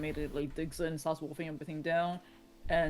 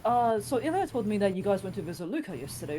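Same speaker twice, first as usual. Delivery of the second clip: concerned father; 7 semitones higher than usual. Acted. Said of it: "Dimitri goes".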